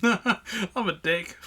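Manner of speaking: laughing through the words, slow. Real, not acted. Host admits fault to his cohost, laughing. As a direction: giggle out a self-jab.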